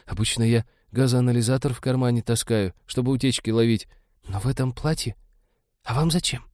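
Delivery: put-on high, puzzled young blonde; timid astonishment. A narrator's reading. Timid blonde wondering why captain wants a detector, astonished. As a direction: answer high, baffled and a little timid. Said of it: "изумилась более пугливая".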